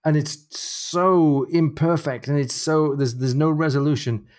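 high, drawn-out lament; pained wail at how bad it is; agonized. Real, not acted. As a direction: stretch each "so" high in real distress. Host agonizes over the painful scene.

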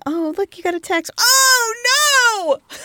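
mock horror. Opening an unwanted picture text, mock-horrified.

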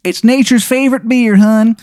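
high-pitched, hammed-up Maryland caller; terrible Maryland accent. Unseen visitor at the cabin door, bad Maryland accent. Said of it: "the worst Maryland accent you've ever heard".

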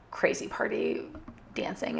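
breathy whispered aside; whispering. Real, not acted. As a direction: drop to a whisper for these words. Aside about the bar's vibe.

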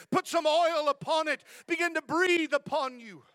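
high, loud, fervent preacher's cry; preaching at full force. Acted. Pastor assuring the struggling believer, rising full-force preaching. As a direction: lift the church up, full voice.

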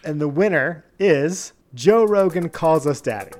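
ordinary talk, nothing marked

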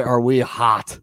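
usual speaking voice

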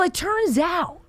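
usual speaking voice

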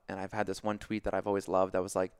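ordinary delivery

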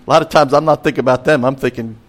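ordinary talk

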